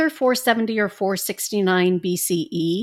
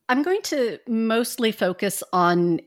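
usual speaking voice

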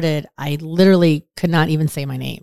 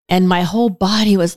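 ordinary delivery

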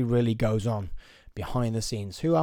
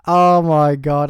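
slow, booming, higher-pitched outcry; loud exasperation; 5 semitones higher, 12 dB louder, much slower than usual. Retold or imitated. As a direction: draw it out loudly in mock disbelief. Exasperated reaction to grandma's reply.

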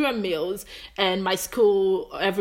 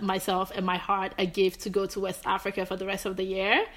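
ordinary talk

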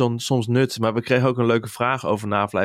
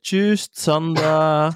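put-on slow, higher, drawn-out German-accented call of the name; German teacher impression; 6 semitones higher, 3 dB louder, much slower than usual. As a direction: stretch it out like a stern German teacher. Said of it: "een soort Duitse omber op mijn school".

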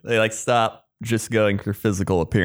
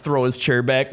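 ordinary delivery

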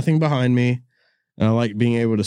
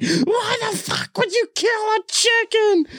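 very high, slow mock scream; a hammed-up, very high-pitched shriek; imitating her scream; slower than usual. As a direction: shriek it as the upset wife. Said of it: "she's screaming".

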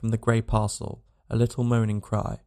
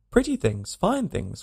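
put-on enthusiastic child; 6 semitones higher than usual. A narrator's reading. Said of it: "cried the little girl enthusiastically".

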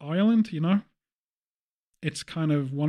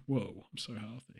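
hushed awe; 6 semitones lower, 13 dB quieter than usual. Pretend. Acted quote, much softer.

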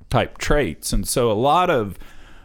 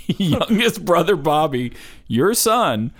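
talking through laughter, pitched up; giggling as the punchline nears; laughing; 8 semitones higher than usual. Real, not acted. Laughing while setting up punchline.

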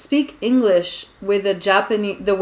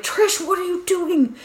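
mock alarmed friends; 9 semitones higher, slower than usual. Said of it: "everyone's like".

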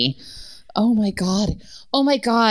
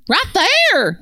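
put-on Southern accent; 9 semitones higher, 4 dB louder than usual. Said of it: "said the woman".

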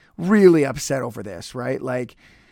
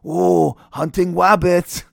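Elmer Fudd; 4 dB louder, slower than usual. Acted. Podcaster spoofing a wrestling manager as Elmer Fudd, mock cartoon voice. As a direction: draw it out in a goofy cartoon speech impediment. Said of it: "that's my Elmer Fudd".

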